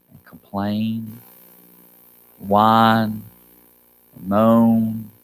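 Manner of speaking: low, slow, stretched words; drawn-out listing. Real, not acted. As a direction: drag out each word like a weary groan. Draws out the complaining words.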